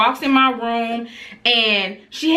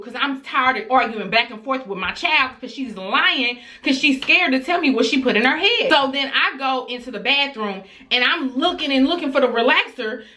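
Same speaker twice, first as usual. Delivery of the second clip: ordinary talk, nothing marked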